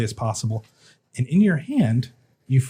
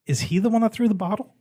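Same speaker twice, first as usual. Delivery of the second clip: lightly voiced dwarf cleric, higher pitched; a questioning visitor, pitched up; 5 semitones higher than usual. Dwarf cleric asking after a patient, lightly in character.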